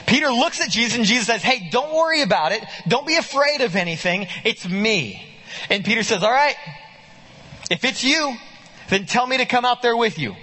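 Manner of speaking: bright narrated dialogue; lively retelling. Acted. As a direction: act out the scene with eager energy. Animated retelling of Peter scene.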